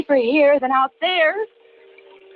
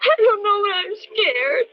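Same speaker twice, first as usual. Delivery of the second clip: scared; 6 semitones higher than usual. Acted. Frightened wife in dark car.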